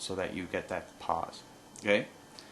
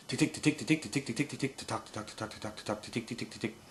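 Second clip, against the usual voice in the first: higher rolling tick tick; 3 semitones higher than usual. Shown as a demonstration. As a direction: roll the ticks, pitched up. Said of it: "a rolling, uh, duck call, which is".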